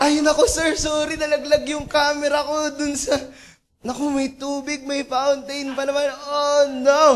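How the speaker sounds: high, loud acted panic; panicked cameraman. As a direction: wail apologies in alarm to the boss. Cameraman panics over dropped camera.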